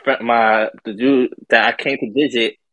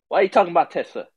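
higher, mock-indignant quote; higher-pitched imitation of an annoyed guy; imitating an irritated man; 7 semitones higher, faster than usual. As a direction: retell his touchy objection, pitched up. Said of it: "he gets even more angry".

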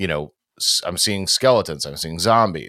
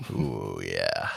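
low, deep sigh after a sip; while sipping a drink; 11 semitones lower, 9 dB quieter than usual. Real, not acted. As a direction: savour the drink with a low ooh. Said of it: "Let me take a sip".

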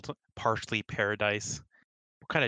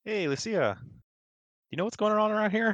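Cal's voice; 12 semitones higher than usual. Acted. Player voices Cal to Lucia.

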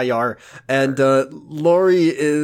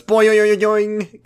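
loud, higher cartoon boings; boing sound effects; 6 semitones higher, 3 dB louder than usual. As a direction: bounce out silly spring noises. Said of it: "I'm gonna do a different bit".

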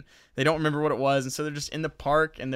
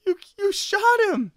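very high, louder goofy shock; dumb shocked squeal; 5 dB louder than usual. Retold or imitated. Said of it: "and he's like".

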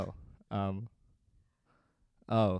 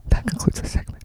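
loud whispered sign-off; mock ASMR whisper; 9 semitones higher, 7 dB louder than usual. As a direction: close the parody segment in a whisper. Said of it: "A S M R laughing".

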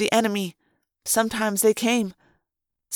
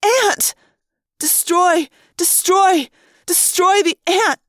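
high, insistent repeated cries; inward scream; 7 semitones higher, 4 dB louder, slower than usual. A narrator's reading. Narrator forces termite self to attack, screaming inwardly. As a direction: drive yourself on with each cry. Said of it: "I screamed the word in my own head".

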